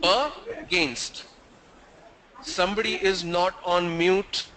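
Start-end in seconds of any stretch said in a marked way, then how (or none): 0.0-1.2 against split as "a... gainst"; two syllables. Shown as a demonstration. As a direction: break against after the first vowel. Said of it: "Against has two syllables".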